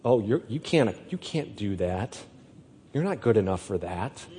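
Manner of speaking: soft, taunting whisper of doubt; accusing inner voice. Retold or imitated. Evil one speaking over David, softly taunting. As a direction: hiss it low like the enemy. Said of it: "I'm starting to hear".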